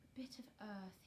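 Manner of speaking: to himself. A narrator's reading. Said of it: "he said to himself".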